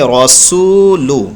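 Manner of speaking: रसूलु drawn out with a heavy रॉ; slow recitation. Shown as a demonstration. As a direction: recite रसूलु slowly, the रॉ full. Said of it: "पूरे जुमले को पढ़ेंगे".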